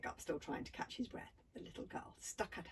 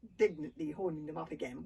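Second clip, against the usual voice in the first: ordinary delivery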